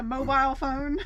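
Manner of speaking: "mobile" said as MO-BILE, with a long i; MO-BILE pronunciation. Retold or imitated. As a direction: say "mobile" to rhyme with file. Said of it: "he said it mobile".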